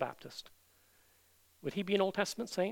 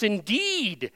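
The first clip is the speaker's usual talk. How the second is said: "indeed" punched louder with a falling tone; emphatic INDEED; 3 semitones higher, 8 dB louder than usual. Shown as a demonstration. Preacher showing the verse's emphasis, "indeed" stressed hard. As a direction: hit "indeed" like an exclamation mark. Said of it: "And it's with emphasis".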